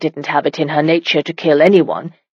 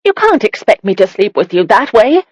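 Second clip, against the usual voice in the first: higher and louder, blurting in alarm; blurted; 5 semitones higher, 4 dB louder than usual. A narrator's reading. Frightened bride blurts to her husband, higher and louder. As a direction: let it burst out nervously. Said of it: "she blurted out".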